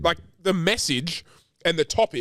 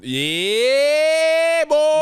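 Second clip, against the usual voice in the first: high, loud drawn-out cheer; excited cheer; 13 semitones higher, 6 dB louder than usual. Real, not acted. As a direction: whoop it out like a win. Cheering about a baby boy.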